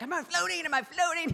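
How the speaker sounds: imitating a child shrieking with excitement; high, loud, thrilled child impression; excited kid. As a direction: cry out like a kid amazed at himself. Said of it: "he's all excited".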